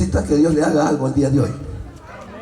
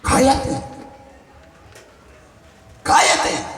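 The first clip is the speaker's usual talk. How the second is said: lightly acted hushing command, pitched up; crowd silencing someone; 11 semitones higher, slower than usual. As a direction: mildly voice a crowd telling him to shut up. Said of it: "la gente lo callaba".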